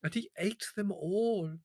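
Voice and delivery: high, slow, wheedling complaint; whining villager. Acted. The servile peasant complains to Leslie: high wheedling whine. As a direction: plead your loss with fawning humility.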